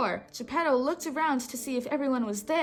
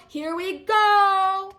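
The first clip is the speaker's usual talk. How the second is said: loud, high, slow shout; 6 semitones higher, 7 dB louder, much slower than usual. A narrator's reading. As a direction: call it over the waves. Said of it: "shouted Pinocchio".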